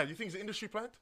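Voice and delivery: usual speaking voice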